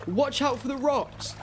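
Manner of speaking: high, urgent call; calling a warning. Acted. Boy warning rowers about the rocks, calling out urgently. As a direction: call out across the water.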